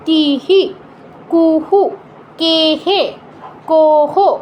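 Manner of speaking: "কিহি, কুহু, কেহে, কোহো" with each vowel repeated after a full h; measured drill of "কিহি, কুহু, কেহে, কোহো", first vowel held, then echoed; visarga vowel echo. Shown as a demonstration. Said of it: "হ এর সাথেও ইকারটা যোগ করতে হবে". ("কিহি, কুহু, কেহে, কোহো", হ-এর সাথে একই কার)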